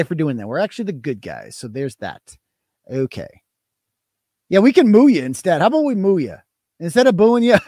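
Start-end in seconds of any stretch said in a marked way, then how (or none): none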